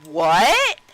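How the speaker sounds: hammed-up startled gasp of a word; Illyria shocked. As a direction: blurt it in mock disbelief. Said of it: "And Illyria's like".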